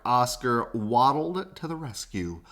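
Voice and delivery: ordinary delivery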